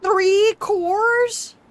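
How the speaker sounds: can't believe the price; shocked squeal. Real, not acted. Gamer reacting to the research cost, shocked squeal. Game demands three cores.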